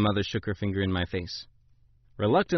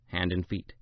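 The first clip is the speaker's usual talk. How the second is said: plain reading voice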